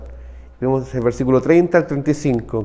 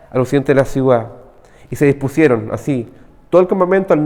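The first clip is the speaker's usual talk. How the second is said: a touch louder, steady reading of the text; scripture reading; 5 dB louder than usual. Acted. Preacher reading Joshua to the congregation, measured reading voice. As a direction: read the passage out to the church.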